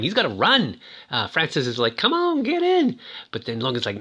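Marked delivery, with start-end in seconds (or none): 2.0-3.0 high-pitched mock holler; playful calling out. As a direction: call a friend over in a much higher voice. Friend calls him into the water.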